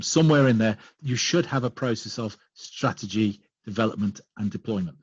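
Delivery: normal talking voice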